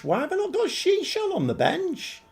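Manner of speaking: can't believe the selection; exasperated at own squad choice; incredulous. Real, not acted. Repeats, more incredulous.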